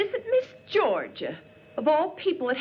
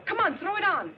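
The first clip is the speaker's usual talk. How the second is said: ordinary talk, nothing marked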